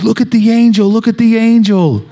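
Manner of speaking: put-on high excited child's voice; small son calling out, high and bright; little boy. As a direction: squeal it like a toddler spotting something. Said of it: "he said".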